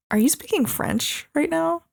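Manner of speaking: high, disbelieving mock question; incredulous. [name] to Annie, incredulous at her French. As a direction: ask like you can't believe your ears.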